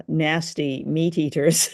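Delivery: ordinary delivery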